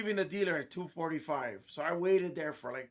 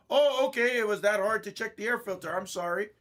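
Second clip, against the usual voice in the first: sarcastic; 4 semitones higher, 5 dB louder than usual. Retold or imitated. Sarcastic reply to clerk.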